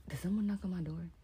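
startled hushed whisper. Real, not acted. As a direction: whisper it, listening for a knock. Startled by a knock, alone.

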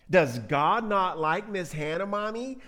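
put-on slow, innocent kid question rising high at the end; little child. Little one asking mommy about God, slow child voice. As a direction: ask mommy like a puzzled child. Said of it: "says the little one".

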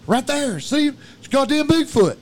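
high, loud urgent cry; panicked sighting. Retold or imitated. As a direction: imitate a friend spotting a monster. Retold panicked Bigfoot sighting.

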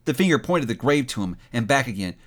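ordinary delivery, nothing marked

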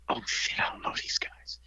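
whispered alarm under the breath; whispered aside. Retold or imitated. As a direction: hiss it to yourself in fear. Inner fear, retold.

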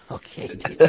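breathy whisper through laughter; whispered laughing. Retold or imitated. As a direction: whisper it, trying not to laugh. Stifled laugh at driver.

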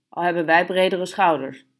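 usual speaking voice

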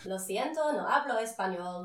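Spanish accent, played up for a laugh; good Spanish accent. Shown as a demonstration. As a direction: show off your best Spanish accent. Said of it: "say in a good Spanish".

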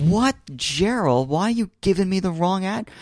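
high-pitched, stretched disbelief; can't believe he gave the wrong address; incredulous outburst. Retold or imitated. To Gerald after the wrong address, high incredulous cry.

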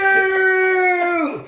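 high, loud drawn-out no; hammed-up despair; anguished cry. Retelling the game, the hero cries out in hammed-up despair. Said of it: "hero's anguished cry".

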